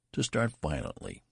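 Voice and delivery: ordinary delivery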